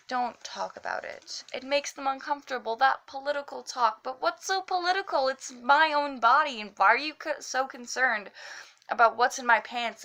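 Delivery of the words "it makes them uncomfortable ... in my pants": higher, pressing, indignant slam delivery; indignant challenge. Acted. Poet confronts those who call their body political, indignant. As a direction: push it out as a pointed demand.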